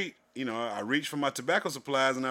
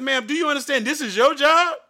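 higher and louder, indignant scolding; heated retort; 9 semitones higher, 6 dB louder than usual. Retold or imitated. Retelling how he told off the cashier, a higher, louder indignant scolding. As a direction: put her in her place, voice raised.

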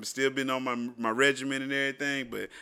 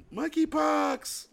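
put-on thrilled kid shout; 12 semitones higher, much slower than usual. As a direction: scream it like a kid seeing cereal. Repeats kid cereal bit.

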